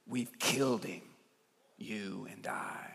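very soft, low, fading to a whisper; slow, hushed verdict with long pauses; grave hushed accusation. Acted. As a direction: let it sink into a near whisper. Madman's grave accusation to the crowd.

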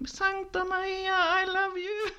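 high, drawn-out imitation of a joyful yell; mock exultant shout. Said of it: "hötte med handen och skrek".

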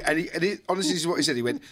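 usual speaking voice

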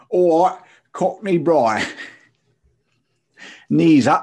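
loud, high, hammed-up Cockney accent; over-the-top Cockney greeting at full volume; bad Cockney accent. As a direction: shout it out as a cheery fake Cockney. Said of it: "I can't do accents".

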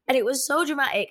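ordinary delivery

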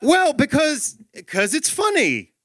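high-pitched, strained explaining; flustered dad. Acted. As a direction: answer on the spot, voice pushed up high. Flustered dad answering the child.

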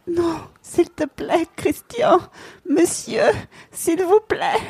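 high, slow begging plea; pleading. Acted. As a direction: beg him, drawn out and high. Acted plea in the book scene.

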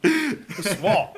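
high, loud comic French Pour Soi; exaggerated French Pour Soi for laughs; mock French accent. Shown as a demonstration. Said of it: "That's better".

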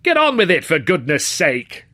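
loud, high impatient heckle; heckling. A narrator's reading. Rude student heckles the silent speaker, impatient. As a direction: heckle from the back. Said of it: "came a voice from the back".

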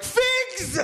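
sudden high squeal of a demand; kid demanding figs. Retold or imitated. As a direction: yelp it like a greedy toddler.

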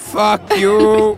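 arg förolämpning. Acted. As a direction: throw it out loudly at the customer. Spelat gräl, andra gången starkare. (högre, utdragen, spelat arg förolämpning)

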